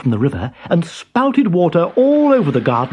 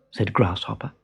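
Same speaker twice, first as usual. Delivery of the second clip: ordinary delivery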